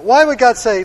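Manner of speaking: usual speaking voice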